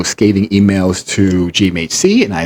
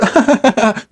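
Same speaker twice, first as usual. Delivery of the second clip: high put-on giggle; mock cackle, pitched way up; imitated laugh; 13 semitones higher than usual. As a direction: mimic his high tittering laugh. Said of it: "He goes".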